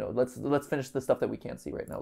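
ordinary delivery

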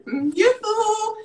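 high sing-song call, slow; sing song yoo hoo. Real, not acted. Calling out playfully.

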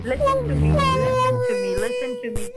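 soft, pleading apology; soothing. Real, not acted. Caller begging customer to stop crying, soothing. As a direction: hush a crying person with soft pleas.

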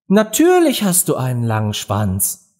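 usual speaking voice

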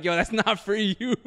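slow, laughing through it. Retold or imitated. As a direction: laugh while brushing off teacher. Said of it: "I was like".

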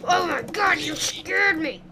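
startled loud yelp; jolted by a sudden scare; jump scare laugh. Real, not acted. Startled by mob.

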